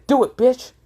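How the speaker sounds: high, loud dare; defiant shout. Acted. Iten daring the fox to eat him, shouting. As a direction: yell the challenge right at him.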